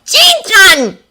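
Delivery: loud, high insistent call. Acted. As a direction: cut through with a sharp cry. Voice breaking into his thoughts.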